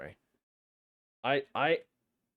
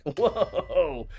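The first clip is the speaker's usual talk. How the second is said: surprised laugh; 8 semitones higher, 9 dB louder than usual. Real, not acted. Chat says you suck.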